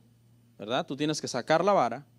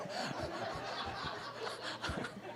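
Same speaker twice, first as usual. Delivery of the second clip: a soft, voiceless laugh; breathy laugh; 13 dB quieter than usual. Real, not acted. Pastor laughing at his own joke.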